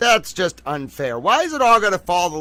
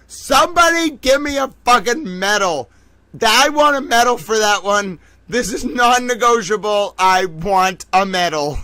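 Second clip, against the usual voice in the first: louder, higher, mock-demanding; hammed-up demand for a medal; excited; 3 semitones higher, 3 dB louder than usual. Real, not acted. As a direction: insist on your medal with swagger.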